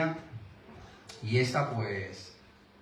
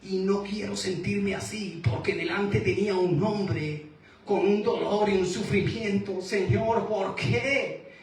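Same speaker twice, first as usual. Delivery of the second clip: rising, higher, anguished plea; pleading to God; 7 semitones higher than usual. Retold or imitated. Preacher retelling his own plea to God, voice rising high. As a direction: climb in pitch as the question breaks out. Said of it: "Y yo decía".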